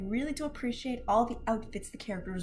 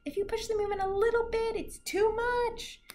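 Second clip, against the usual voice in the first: mock worried parent; 10 semitones higher than usual. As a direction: play a fretting parent, pitched up. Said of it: "'cause it's".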